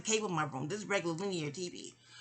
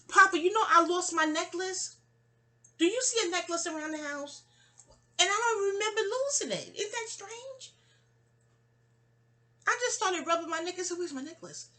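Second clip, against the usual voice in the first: much higher voice, asking Papa for help; high-pitched calling out; 11 semitones higher, 4 dB louder than usual. Real, not acted. Speaker asks Papa about her lost necklace, high-pitched and puzzled. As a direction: call out high, puzzled over a loss.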